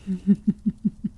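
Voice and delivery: a short knowing laugh; teasing vampire chuckle. Acted. Vampire amused at hunter.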